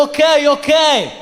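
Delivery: mock Russian woman, slow and high; Russian accent. Woman scaring off her boyfriend with a mock Russian accent. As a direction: challenge him in a Russian accent. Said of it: "get your Russian out".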